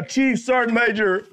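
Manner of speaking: normal talking voice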